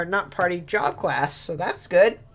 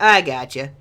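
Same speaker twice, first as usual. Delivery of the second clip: louder, insistent I gotcha; louder mock reassurance; 12 dB louder than usual. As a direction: push the second I gotcha out louder. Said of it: "they're like".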